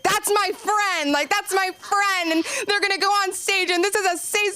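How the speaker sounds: high-pitched, defensive outburst; re-enacting a worked-up, protective rant; fiercely defensive. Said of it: "I got so defensive".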